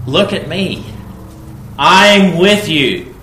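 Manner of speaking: loud, high, slow I'm with you; emphatic promise. Acted. God assuring the fearful people, emphatic and slow. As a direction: plant the promise firmly. Preacher voicing God's promise.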